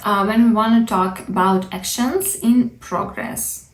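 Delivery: usual speaking voice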